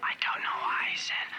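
hushed, unvoiced aside; quick self-conscious whisper. Alone, muttering while spying.

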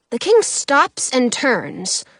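plain reading voice

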